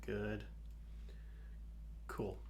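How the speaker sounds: normal talking voice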